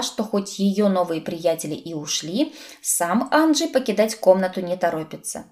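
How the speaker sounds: ordinary delivery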